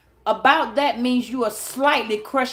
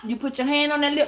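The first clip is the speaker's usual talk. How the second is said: ordinary talk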